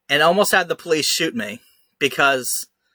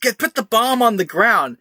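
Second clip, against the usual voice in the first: mock cop shout, pitched higher; retold cop yell; 7 semitones higher than usual. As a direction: bark the order like the cop did, retelling it. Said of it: "he screams".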